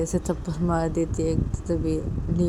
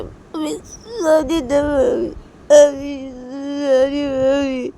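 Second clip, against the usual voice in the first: put-on very slow, high little girl's apology; child's sorry; 8 semitones higher, 5 dB louder, much slower than usual. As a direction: stretch each word like a small child. (बच्ची जैसी खिंची हुई माफ़ी)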